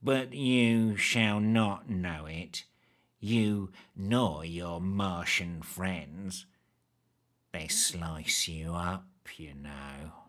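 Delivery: low, very slow, soft droning; low drawl. Acted. Creepy man corrects the hag, droning drawl. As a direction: drone the name contemptuously. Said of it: "he drawled".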